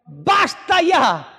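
high, drawn-out shout of protest; a prophet crying out in protest; desperate cry to God. Retold or imitated. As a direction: yell it out, begging God to stop. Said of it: "qué le dice Elías a Dios".

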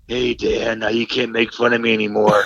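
mock-menacing, hushed hero impression; Batman. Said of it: "I'm Batman".

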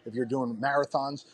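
ordinary delivery

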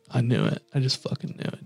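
whispered under the breath, triumphant; whispered gloat. Retold or imitated. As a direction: hiss of told-you-so, whispered. Gloating to herself about mom.

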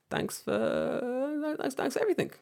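very high, squeaky thanks; hammed-up sheepish sign-off; awkward mock goodbye. Acted awkward exit from meeting.

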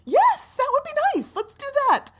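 high, loud burst of eagerness; desperately eager. Acted. Freezing passenger answers captain's offer of Africa, desperately eager. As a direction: jump at the offer in a high, excited cry.

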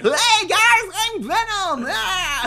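monster bursting out in a loud roar; Venom. Acted. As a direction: roar it like a creature taking over. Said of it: "Venom will sometimes just come out".

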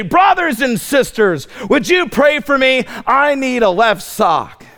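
a teasing hint of a begging missionary; mock missionary plea. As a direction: poke fun at a visiting missionary asking for prayer. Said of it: "he would say".

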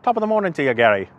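amateur attempt at an Irish accent; bad Irish accent. Greeting Gary from Ireland, poorly faked Irish accent. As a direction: put on a stock Irish greeting, badly. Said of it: "me trying to be Irish".

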